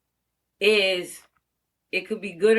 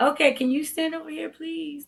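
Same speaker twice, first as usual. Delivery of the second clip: higher, gentle ask fading soft on please; teacher gently asking a timid girl; softer with a timid child; 7 semitones higher than usual. Shown as a demonstration. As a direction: coax a shy little girl kindly. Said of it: "That softer".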